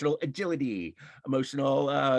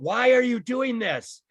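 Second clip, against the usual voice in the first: higher, louder and slow, like a cry from hiding; retold yell; 9 semitones higher, 5 dB louder, slower than usual. Witness in hiding yelling at the shooter, higher and louder. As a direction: call it out from the crowd, pleading. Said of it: "yelled out".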